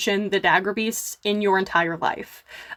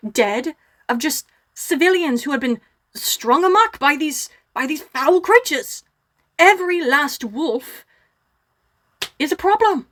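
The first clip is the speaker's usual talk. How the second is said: outraged dwarf orator, pitch jumping high; pissed-off dwarf; 4 semitones higher than usual. Acted. Shumley slams the table and denounces the wolves, angry and impassioned. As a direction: rail against them with emphatic, raised voice. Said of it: "Shumley Boulder's pissed".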